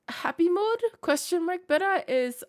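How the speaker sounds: mock upbeat. Mock announcing dropped persona.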